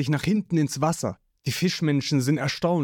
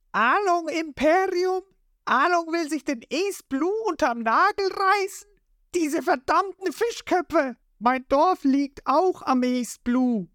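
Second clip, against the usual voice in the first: much higher, indignant voice; outraged. A narrator's reading. Lysop outraged at Along's empire, high and indignant. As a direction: fume at the fish-men high.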